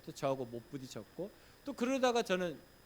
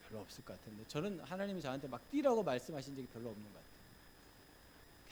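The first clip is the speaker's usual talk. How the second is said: ordinary talk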